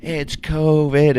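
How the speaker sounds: high, slow mocking whine of panicked locals. Host mocking neighbours who blame the rain on covid, high slow mock panic. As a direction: send up the neighbours crying covid, pitched high and drawn out. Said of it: "everybody in the area is like".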